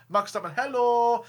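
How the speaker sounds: a hammed-up mock Dutch accent, high and loud on hello; a clumsy fake Dutch accent; bad Dutch impression. Host teasing a driver in a bad mock Dutch accent. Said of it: "That's a really bad Dutch impression".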